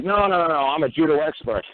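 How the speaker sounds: high-pitched imitation of a protest; mock objection, pitch jumping up then easing down. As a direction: insist in a high, put-on voice. Acting out the Crown's protest.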